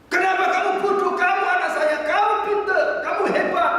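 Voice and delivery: high, loud scolding shout; angry father. Retold or imitated. Professor father scolding his son over grades, angry shouting. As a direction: berate the child at full volume. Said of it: "dia marah-marah pada anaknya".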